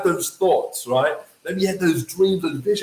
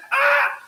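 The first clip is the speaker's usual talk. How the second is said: panicked cry. Retold or imitated. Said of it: "And I'm just like".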